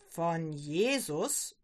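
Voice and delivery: low, slow, incredulous question; agitated interruption. A narrator's reading. Father interrupts daughter's story about Jesus, upset. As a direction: cut in with drawn-out disapproval. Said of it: "unterbricht sie der Vater aufgebracht".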